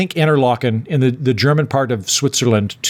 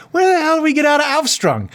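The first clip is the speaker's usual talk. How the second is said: high-pitched comic outburst; mock desperate demand for a way out; exasperated; 12 semitones higher than usual. Lost traveller asking how to get out, exasperated. Retold outburst to ask for the exit.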